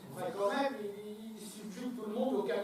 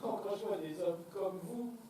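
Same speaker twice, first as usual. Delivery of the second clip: ordinary talk, nothing marked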